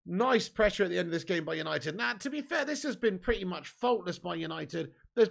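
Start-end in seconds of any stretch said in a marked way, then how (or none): none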